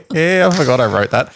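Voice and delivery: high, giggly admission; laughing. Real, not acted. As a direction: talk through real laughter. Amused by own script.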